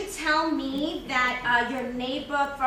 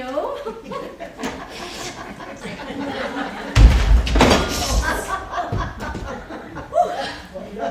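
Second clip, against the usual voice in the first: long loud laugh; 6 dB louder than usual. Acted. Stuck in old elevator again.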